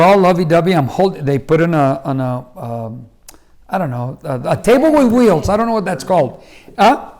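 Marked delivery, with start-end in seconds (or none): none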